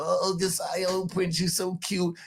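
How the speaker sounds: put-on high cooing baby talk; mock doting coo. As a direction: coo at the boy like a doting relative. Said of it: "They're gonna love him to death".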